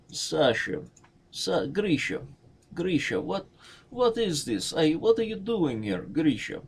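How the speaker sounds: high mock Russian, surprised; playful Russian character bit. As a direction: call the names like a startled Russian. Voicing Russian characters scene.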